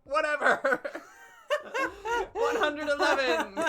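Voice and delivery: losing it laughing while reading; cracking up. Real, not acted.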